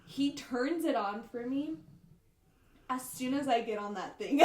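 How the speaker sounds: normal talking voice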